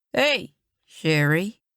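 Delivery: put-on light Cajun accent, drawn out slowly; a greasy carnival vendor, lightly Cajun. A narrator's reading. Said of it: "a strong Cajun accent".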